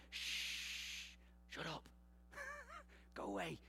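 imitating people hushing him, hushed and soft; hushing crowd. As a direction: shush him like an annoyed crowd. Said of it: "I can hear in between the text people like".

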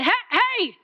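mock yell to get attention; retold shout. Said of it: "I screamed at that man".

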